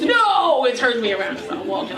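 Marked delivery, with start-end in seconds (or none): 0.0-0.6 embarrassed "No!". Retold or imitated. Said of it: "She goes".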